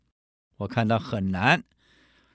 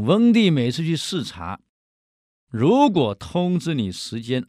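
usual speaking voice

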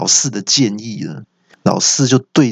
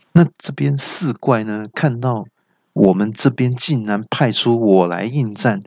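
ordinary delivery

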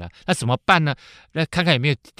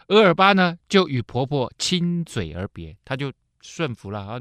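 normal talking voice

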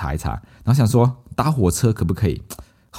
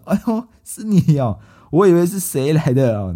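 high, drawn-out motherly exclamation, slow; mom's surprised recognition; 8 semitones higher than usual. Retold or imitated. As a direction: imitate mom going oh it's you. Mom recognizes hidden son.

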